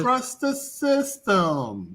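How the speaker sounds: slow sing-song catchphrase. Pretend. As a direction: stretch it out like a slogan. Host drawls catchphrase slowly.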